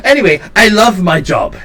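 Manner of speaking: high, loud burst settling into introductions; exuberant shopkeeper. Acted. Shopkeeper introducing himself to the newcomer, high and exuberant. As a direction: declare it gleefully, then introduce yourself. Game master voices eccentric shopkeeper.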